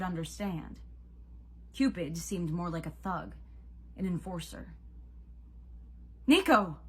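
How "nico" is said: loud, higher call. Acted. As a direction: shout across to him. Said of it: "he called".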